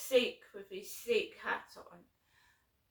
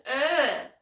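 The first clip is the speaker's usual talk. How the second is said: loud, disgusted grunt; annoyed grunt; 3 semitones higher, 10 dB louder than usual. Retold or imitated. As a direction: huff like the fed-up driver. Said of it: "like that, can hear it".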